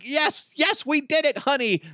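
high, giddy mock cheering; excited celebration. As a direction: cheer like you just won the jackpot. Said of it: "Be like".

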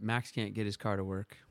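usual speaking voice

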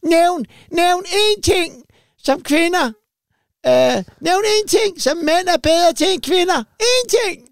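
high, slow, insistent mock woman's demand; shrill demanding comedian. A comedian hammering the crowd with a high, slow demand. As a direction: stretch out each word like a comic demanding an answer. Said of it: "Og så var hun sådan".

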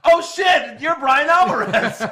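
loud, high stunned shout; starstruck shock. Retold or imitated. Podcaster meeting Brian Alvarez, shouting high in starstruck shock. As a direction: blurt it out, suddenly realising who he is. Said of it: "I was like".

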